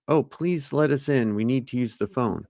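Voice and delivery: stilted, formal line learned by heart; memorized polite plea. Acted. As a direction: recite it like an awkward, overly polite kid. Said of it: "formal, polite, strange, awkward, and memorize lines".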